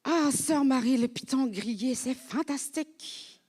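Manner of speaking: high, gushing mock delight; enthusiastic host. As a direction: imitate a host raving about grilled python. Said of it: "quand on m'a dit".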